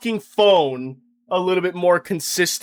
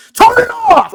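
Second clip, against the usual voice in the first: sudden furious yell, much higher and louder; shouted command; 10 dB louder than usual. Acted. Yelling at Garen to turn off his TikToks, furious shout. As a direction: snap at him to shut it off.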